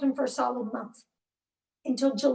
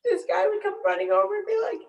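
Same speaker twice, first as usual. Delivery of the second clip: real crying, high strained voice; tearful; 6 semitones higher, 3 dB louder than usual. Real, not acted. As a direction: push the words out through tears. Tearful account of supervised visit.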